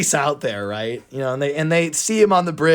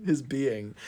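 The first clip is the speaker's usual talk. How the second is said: ordinary talk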